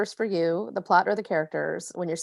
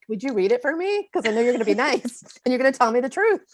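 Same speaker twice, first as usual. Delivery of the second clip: eager, laughing request; 6 semitones higher, 6 dB louder than usual. Retold or imitated. Asking the aunt to read.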